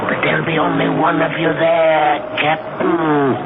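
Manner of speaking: ordinary delivery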